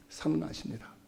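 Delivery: ordinary delivery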